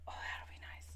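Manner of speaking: whispered echo of the line. Real, not acted. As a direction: repeat it in a hush. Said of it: "repeated as whisper".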